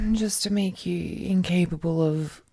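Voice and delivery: usual speaking voice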